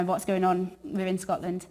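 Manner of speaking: ordinary talk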